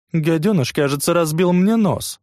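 put-on high whining girl; whimpering complaint. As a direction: whine for pity. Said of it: "продолжает скулить Ооцука". (скулящая жалоба)

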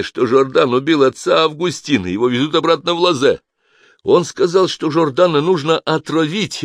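high, loud, anxious account; urgent frightened testimony; agitated confession. A narrator's reading. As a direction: spill it all, pleading to be believed. Guard confesses under questioning.

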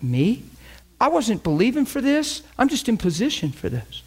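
high-pitched, incredulous self-defence; mock-bewildered, voice lifting high; startled protest. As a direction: protest your innocence in disbelief. Acting out startled protest at blame.

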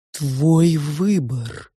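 very slow, stretched character voice; ominous two-faced Sofya. A narrator's reading. Sofya asks the girl for her choice, slowly probing. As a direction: draw each word right out.

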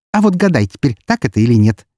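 mildly malicious challenge; snide teasing. A narrator's reading. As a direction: tease him with a smirk in the voice. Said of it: "ехидно усмехнулась она".

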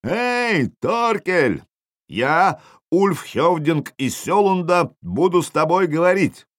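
high, drawn-out battlefield call; yelling at full throat. A narrator's reading. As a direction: call out a challenge across the distance. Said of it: "и заорал во всю глотку".

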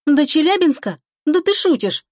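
can't believe what you just heard; shocked disbelief. A narrator's reading. Girl balking at the trip to Chelyabinsk, indignant disbelief.

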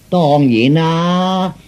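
slow, stretched final syllable; drawn-out assurance. Acted. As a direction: draw it out as if utterly obvious. Fortune teller justifies his price. (拉長聲話當然)

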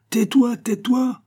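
very high, drawn-out plea for silence; exasperated outcry. A narrator's reading. Abbot silencing Pitou's defence, in exasperated cry.

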